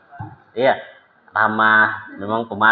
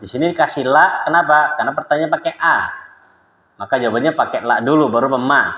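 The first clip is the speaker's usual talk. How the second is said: ordinary talk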